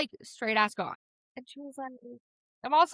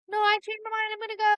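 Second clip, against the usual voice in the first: higher, hurried brush-off; jittery higher exit line; nervous girl; 10 semitones higher, faster than usual. Retold or imitated. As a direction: get away from him politely but fast. Girl quickly leaving dealer.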